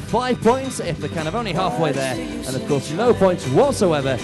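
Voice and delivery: loud, high announcer over music; upbeat cruise director. Acted. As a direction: whip up the crowd like a host.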